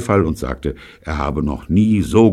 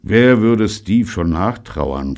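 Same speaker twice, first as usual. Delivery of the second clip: menacing; 5 semitones higher, 6 dB louder, slower than usual. Acted. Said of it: "fragte Gordon".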